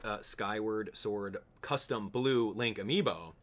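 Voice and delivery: normal talking voice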